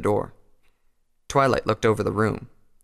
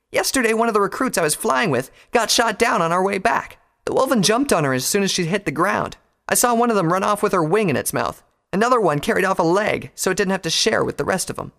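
high-pitched angry account; heated, indignant; 10 semitones higher, 4 dB louder than usual. A narrator's reading. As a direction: argue your case with raised voice. Rainbow's angry account of the attack.

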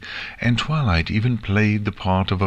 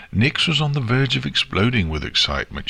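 ordinary delivery, nothing marked

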